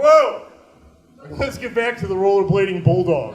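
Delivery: loud startled exclamation; loud mock alarm; startled whoa. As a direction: jump back in alarm, then change subject. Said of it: "You're like".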